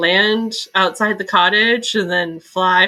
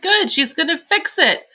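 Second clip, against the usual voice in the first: higher, pleased and hopeful; hope things are finally getting fixed; reacting to the book, with mock relief; relieved hope; 8 semitones higher than usual. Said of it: "And I was like".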